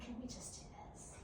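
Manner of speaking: fast whispered dog thought. Retold or imitated. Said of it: "He's like".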